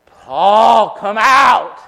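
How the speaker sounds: high, loud summons; shouted command by name; loud call. Acted. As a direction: call him out of darkness. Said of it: "he calls Paul".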